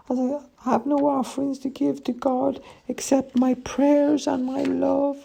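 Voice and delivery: very high, thin pleading voice; frail old woman. A narrator's reading. Poor old woman explaining her theft to her rescuer, pleading. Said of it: "frail, and old".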